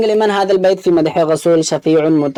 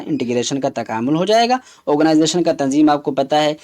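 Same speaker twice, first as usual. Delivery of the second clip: normal talking voice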